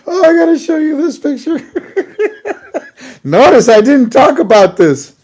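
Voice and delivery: can barely get it out for laughing; breaking up at a picture; laughing hard. Real, not acted. Cracking up at a meme.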